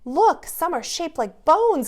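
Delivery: put-on delighted little girl; excited giggle. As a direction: Katie spotting bone-shaped presents, delighted. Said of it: "giggled Katie".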